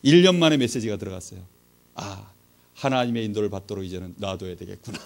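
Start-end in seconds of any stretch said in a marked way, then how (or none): 1.9-5.0 low, soft retold realization; quiet resignation. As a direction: voice a mother quietly giving in. Mother quietly accepts in retelling.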